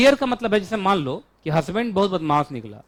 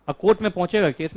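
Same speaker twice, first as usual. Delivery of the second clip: usual speaking voice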